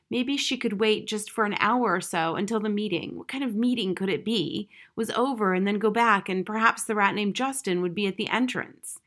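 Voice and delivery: plain reading voice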